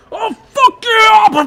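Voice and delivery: very loud, high, drawn-out holler; rowdy brawler. Acted. Conjured male voices faking a brawl as a distraction, rowdy shout. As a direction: shout like a man starting a fight. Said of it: "these three different male voices going".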